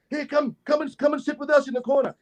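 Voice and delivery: high-pitched imitated welcome; eager invitation. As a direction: beckon them over, voice up high. Acts out stranger's eager invitation.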